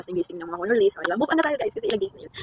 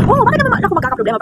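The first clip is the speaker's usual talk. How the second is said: high, louder defensive retort; harsh reply; 10 semitones higher, 6 dB louder than usual. Retold or imitated. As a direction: toss it back sharply, annoyed. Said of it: "harsh lang yung pagkasabi ko". (mataas at malakas na pabalang na sagot)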